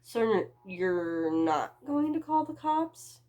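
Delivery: cautious, testing the waters; wary check. Acted. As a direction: make sure you're safe. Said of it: "making sure my ass was covered".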